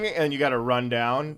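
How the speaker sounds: normal talking voice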